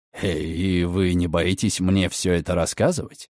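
low, soft, musing male drawl; thoughtful drawl. Acted. Redfern eyes the girl, musing low drawl. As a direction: weigh her up as you drawl it. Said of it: "задумчиво протянул Редферн".

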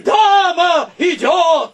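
slow, high, drawn-out shout; loud announcement. Acted. Gromov loudly announcing the lady to his friends. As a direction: herald the lady's arrival.

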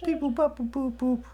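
high beeping robot voice; toy robot voice. Pretend.

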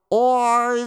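high-pitched yelp, no real shout; understated cry of alarm; mild cry. A narrator's reading. Monk cornered by the swordsman, mild cry. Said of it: "закричал Горанфло".

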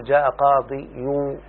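قاضي يو slowed, with a separate "yu" after "qadi"; heavy damma ending. Shown as a demonstration. Teacher showing why the form is avoided, heavy damma. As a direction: sound out the awkward "yu" on قاضي يو. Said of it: "فالضمة والكسرة ثقيلان على اللسان".

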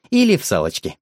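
ordinary delivery

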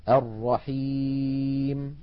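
الرحيم with the ي lengthened, stopped on the م; pausal recitation. Shown as a demonstration. Teacher recites the ي example, slowly. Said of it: "هما الواو".

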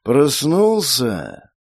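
slow detached serpent hiss. Acted. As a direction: hiss it out, aloof. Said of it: "прошипел с некоторой отстранённостью дух".

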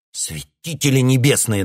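furious cry; angry outburst. A narrator's reading. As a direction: lash out at him. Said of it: "набросился на него Грей".

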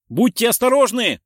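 loud, high-pitched urgent cry; warning shout. A narrator's reading. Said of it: "крикнул он". (тревожный предупреждающий крик)

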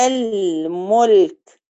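الملك with the damma on the ميم kept short and slow; الملك, slow with a clipped u; short damma demo. Shown as a demonstration. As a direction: say الملك slowly, the ميم vowel half a long vowel. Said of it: "الملك، الميم مضمومة".

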